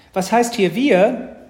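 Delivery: high, loud, drawn-out retort; indignant little sister. A narrator's reading. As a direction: throw it back like a miffed little girl. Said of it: "entgegnete Mathilda".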